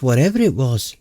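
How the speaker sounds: usual speaking voice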